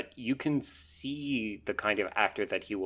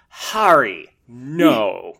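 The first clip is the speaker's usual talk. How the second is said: attacking the line; 3 semitones higher, 12 dB louder, much slower than usual. Retold or imitated. Host mimics an actress overdoing her lines, attacking each word. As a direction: hit each word hard, drawn out, like a hammy child actor. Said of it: "almost like attack the line".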